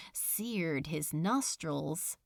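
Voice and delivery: usual speaking voice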